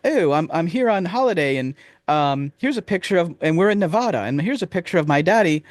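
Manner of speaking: put-on high, innocent little-boy voice; high childish holiday storyteller; Simon voice. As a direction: chirp it like a proud child showing drawings. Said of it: "He's like".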